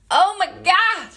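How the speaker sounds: high-pitched, thrilled outburst; gushing excitement. Real, not acted. Host reacts to a quote she loves, gushing excitement. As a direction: burst out, thrilled.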